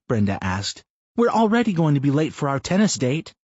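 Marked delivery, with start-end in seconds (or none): 1.2-3.3 Brenda's voice. Acted. Wife teasing her husband mid-run, higher voiced. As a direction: narrator lifts into a higher, lightly voiced wife.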